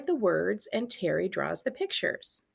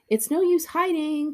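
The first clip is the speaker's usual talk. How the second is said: raised calling voice, higher; 8 semitones higher, 4 dB louder than usual. Acted. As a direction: call across to the treehouse. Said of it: "called Jill".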